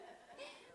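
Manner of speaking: unvoiced high teary laugh; breathy tearful laugh. Real, not acted. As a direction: laugh through tears. Laughing through tears.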